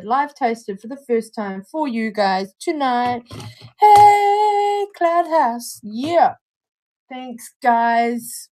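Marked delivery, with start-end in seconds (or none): 3.8-6.3 high loud cheer; big happy shout-out; excited cheer. Real, not acted. Cheering the brand on stream.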